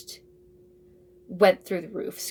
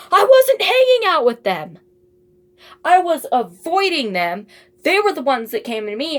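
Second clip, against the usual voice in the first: loud, high indignant protest; indignant outburst; 8 semitones higher, 11 dB louder than usual. Real, not acted. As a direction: protest the unfair advice, much louder and higher.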